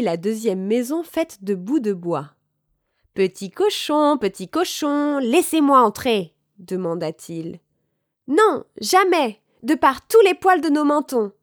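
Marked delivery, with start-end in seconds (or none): 3.1-6.3 high sing-out wolf request; wolf calling piglet. A narrator's reading. Loup demande aux cochons d'ouvrir. 8.3-11.3 defiant little pigs. A narrator's reading. Deux cochons refusent au loup.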